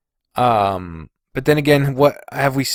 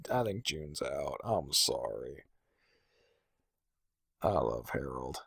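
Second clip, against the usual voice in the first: much softer, lower, resigned; quiet reluctant verdict; 11 semitones lower, 19 dB quieter than usual. Real, not acted. Eliminating June, a quiet and reluctant verdict. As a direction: say it softly, as if it hurts.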